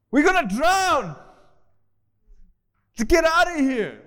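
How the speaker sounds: loud, high panicked shout; freaking out. Acted. Believer flipping out in a storm, panicked. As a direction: holler it in acted terror, voice way up. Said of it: "You freak out".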